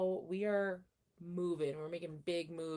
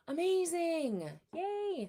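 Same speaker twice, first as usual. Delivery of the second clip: excited praise; 11 semitones higher, 3 dB louder, much slower than usual. Real, not acted. Praising a viewer's progress.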